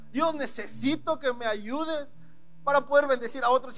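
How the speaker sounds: usual speaking voice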